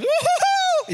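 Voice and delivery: high, loud, long woo-hoo of joy; excited whoop. Acted. Wife greeting husband back from the army, excited whoop. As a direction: whoop shrilly with delight. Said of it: "She's so excited to see you".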